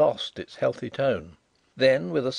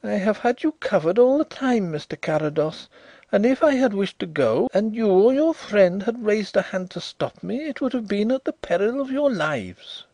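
high, louder, sorrowful victory; melancholy triumph; 10 semitones higher, 6 dB louder than usual. A narrator's reading. Visitor reveals his revolver, melancholy triumph. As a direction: triumph tinged with gloom. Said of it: "in a voice of melancholy triumph".

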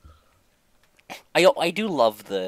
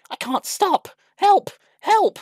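very high, loud cries for help; panicked wail; 7 dB louder, slower than usual. A narrator's reading. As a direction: cry out as a runaway engine. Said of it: "he wailed".

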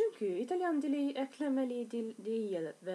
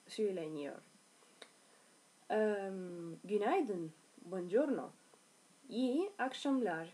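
ordinary talk, nothing marked

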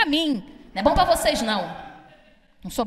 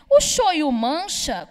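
husband's question; much slower than usual. Retold or imitated. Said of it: "ele falou assim pra mim".

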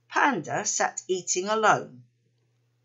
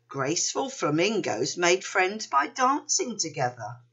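plain reading voice

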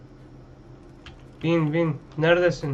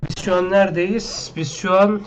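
confused outburst; 4 semitones higher, 5 dB louder than usual. Real, not acted. Lost in game, calls out confused.

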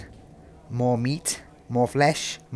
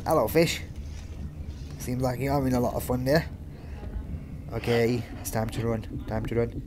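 ordinary delivery

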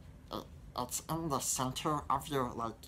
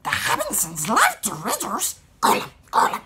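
loud, much higher hissing Gollum; 13 semitones higher, 14 dB louder than usual. Shown as a demonstration. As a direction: croak and hiss like the creature. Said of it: "For all you Lord of the Rings fans".

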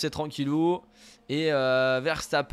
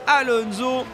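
motorsport commentator; 4 semitones higher than usual. Acted. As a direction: build the tension of a side-by-side battle. Calling an overtake live. (commentateur de course en direct, tendu)

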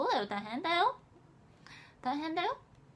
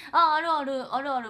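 excited; 2 semitones higher, 9 dB louder than usual. Real, not acted. Excited reply to Kumamoto question.

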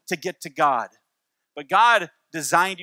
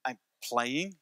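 disgusted; 6 semitones lower, 9 dB quieter than usual. Retold or imitated. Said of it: "she looked at me so disgusted".

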